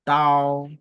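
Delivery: "Tao" loud and dead level; strong, unbending "Tao"; first tone. Shown as a demonstration. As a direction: hold "Tao" on one steady note. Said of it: "le ton constant, le premier ton".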